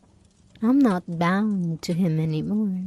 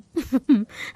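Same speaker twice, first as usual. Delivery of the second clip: gleeful sadistic giggle; cruel giggle; 4 semitones higher than usual. Acted. Doll laughs recalling grandfather's screams, cruel giggle. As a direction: laugh at his pain.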